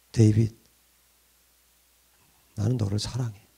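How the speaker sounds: God quietly reassuring the doubting pastor, tender; tender divine voice. Acted. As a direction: speak as a loving God, quietly. God's voice answering pastor.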